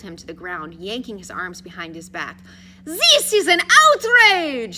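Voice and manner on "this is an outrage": high, loud, indignant fake French accent; outraged mock Frenchman; questionable French accent. A narrator's reading. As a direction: protest slowly in a hammed-up French accent. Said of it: "in his questionable French accent".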